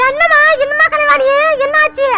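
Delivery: a fussing grandmother rushing to help; worried grandmother. Acted. Actor voices worried grandmother.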